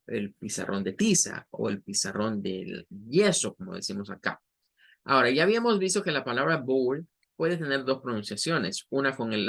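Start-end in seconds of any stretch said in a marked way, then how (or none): none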